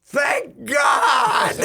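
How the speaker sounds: high, loud heckler's holler; shouting in mock relief; imitated yell. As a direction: bellow it drawn out from the crowd. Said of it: "and he yells".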